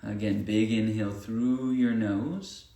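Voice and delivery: unhurried breathing guidance with long pauses; guided meditation. Acted. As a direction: lead them through each breath, leaving room between phrases.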